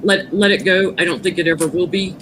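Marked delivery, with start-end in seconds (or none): none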